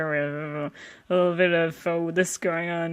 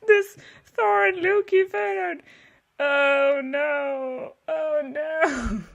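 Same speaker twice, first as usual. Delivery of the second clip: high wailing, on the edge of laughter; dismayed laughter; 7 semitones higher, slower than usual. Real, not acted. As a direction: wail in amused horror, barely holding it together. Cracking up at dark old art.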